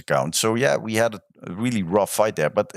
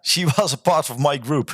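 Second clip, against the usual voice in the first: higher-pitched, laughing recognition; amused laughing; 4 semitones higher than usual. Real, not acted. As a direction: laugh through the words.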